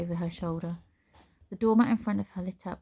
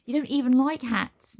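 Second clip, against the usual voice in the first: grumpily; 6 semitones higher, 6 dB louder than usual. Acted. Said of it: "Nine grumpily said".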